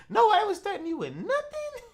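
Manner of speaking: high, fast disbelieving question; incredulous. Real, not acted. Host grilling the guest, high fast disbelief.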